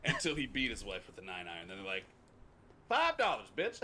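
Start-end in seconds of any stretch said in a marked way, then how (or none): 2.9-3.8 high, loud, dismissive jab; mocking lowball offer. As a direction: spit out the cheap offer loudly.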